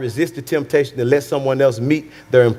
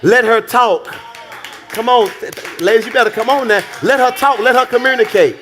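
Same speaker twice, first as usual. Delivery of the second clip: preaching emphasis; 5 semitones higher than usual. Real, not acted. Preacher exhorting the men.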